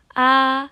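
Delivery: loud, high level "A"; "A" stretched on one steady high pitch; first tone. Shown as a demonstration. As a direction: hold "A" high without moving. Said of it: "nada satu datar".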